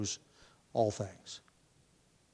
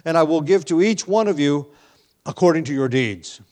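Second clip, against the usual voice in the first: louder, raised voice declaiming the verses; scripture reading; 4 semitones higher, 6 dB louder than usual. Acted. Pastor reading Revelation to the congregation, declaiming loudly. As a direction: proclaim the Lord's words from the pulpit.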